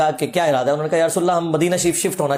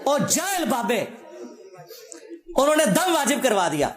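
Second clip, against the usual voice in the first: laughing mockery; 7 semitones higher than usual. Real, not acted. Mocking the miracle tale.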